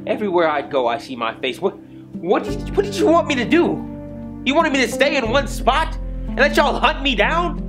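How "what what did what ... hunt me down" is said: very high, indignant questioning; desperate angry challenge. Acted. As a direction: stammer into an exasperated outcry. Accused character challenges accuser.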